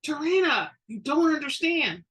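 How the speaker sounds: high, loud urgent plea; pleading exclaim. Acted. Best friend pleading with the angry witch, high urgent exclaim. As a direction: insist she's got it wrong. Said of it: "Sandra exclaimed".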